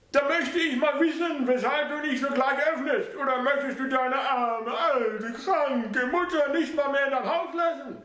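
guilt-trip her son; high mother's voice, martyred; self-pitying mother. A narrator's reading.

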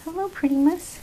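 normal talking voice

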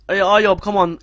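high, loud yell at a player; sudden impatient holler; urging shout. Real, not acted. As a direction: urge the striker on loudly. Urging player during live attack.

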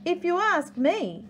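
high know-it-all voice; platypus voice. Acted. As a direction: lift it up like a busybody. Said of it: "said Platypus".

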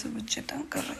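soft unvoiced whisper; hushed tired whisper. Real, not acted. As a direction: whisper the complaint so no one wakes. Night, family sleeping.

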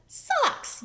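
high, loud cartoon-duck yell; sudden high-pitched shout. A narrator's reading. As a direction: holler it way up high. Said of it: "yelled Duck".

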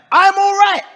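high, loud and bright with forced cheer; sudden high loud pleading "I'm all right"; mock eager reassurance. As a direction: overeager vouching, voice jumping up high and loud. Friend's quote, rises sharply.

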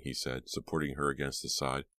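ordinary delivery, nothing marked

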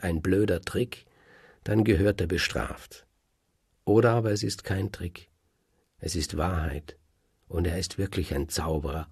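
usual speaking voice

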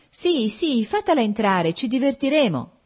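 put-on high, excited young voices; eager chorus. A narrator's reading. Young guests clamour for the fortune-teller, eager chorus. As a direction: clamour eagerly for some fun. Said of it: "dissero in coro tutti i giovani".

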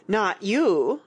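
dismissive, emphatic retort; contemptuous. A narrator's reading. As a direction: put them down with scorn. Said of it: "Tweedledee retorted contemptuously".

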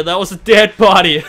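normal talking voice

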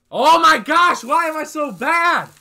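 high, loud, drawn-out self-blame; exasperated shout. Real, not acted. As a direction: scream at yourself for the mistake. Self-blame outcry after mistake.

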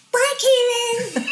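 put-on drawn-out tiny kid's goodbye with a laugh. Dad says bye to his son at school in a high kid voice. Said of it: "I've always been able to do The Voice".

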